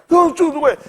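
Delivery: high put-on voice of the country man; imitating that guy. Repeating the country man's order in a high voice. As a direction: toss it off like the old man would. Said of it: "Talk like that guy".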